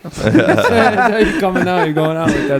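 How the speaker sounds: high, slow, laughing through the words. Real, not acted. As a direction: laugh while you say it. Laughing at his own joke.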